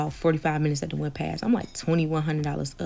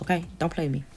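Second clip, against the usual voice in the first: normal talking voice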